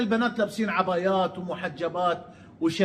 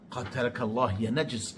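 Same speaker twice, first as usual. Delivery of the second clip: low, much softer curse under the breath; muttered curse; 8 semitones lower, 10 dB quieter than usual. Real, not acted. Vlogger cursing Amr under his breath, muttered. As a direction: hiss the insult half to himself.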